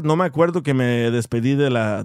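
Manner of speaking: usual speaking voice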